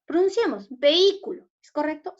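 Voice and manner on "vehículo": "vehículo" with the accented Í punched high and loud; stress on I. Shown as a demonstration. Teacher checking the syllable with pupils, stress on Í in "vehículo". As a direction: hit the Í in "vehículo". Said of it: "mayor fuerza de voz está en la i".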